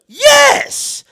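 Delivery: very high, loud screamed yes; hammed-up shriek of agreement; exasperated yell. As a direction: yell it at the top of the voice. Retells emphatic answer to cop.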